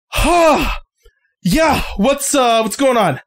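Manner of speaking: lightly voiced dazed teen, high; a hint of a groggy kid just woken; dazed guy. Half-asleep teen answering the door, mildly dazed. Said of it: "just goes".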